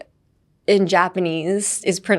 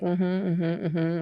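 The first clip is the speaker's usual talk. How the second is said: soft, insincere "mm-hmm" through a fake smile; 2 semitones lower, 6 dB quieter, much faster than usual. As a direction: hum along politely without meaning it. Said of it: "that fake smile when you're like". (作り笑いで小さく相づち)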